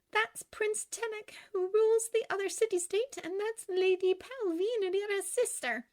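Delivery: put-on high-pitched young woman; high, girlish storytelling; girlish Glinda, explaining. A narrator's reading. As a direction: introduce them in a high character voice. Glinda's character voice.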